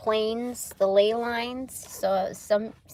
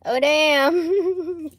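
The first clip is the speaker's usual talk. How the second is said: loud surprised exclaim; 7 semitones higher, 9 dB louder than usual. Real, not acted. Repeats surprise loudly with laugh.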